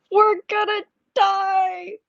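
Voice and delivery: high, slow, drawn-out mock panic; kid yelling in panic. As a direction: imitate a small child sure he'll die on the ride. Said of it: "just starts yelling out".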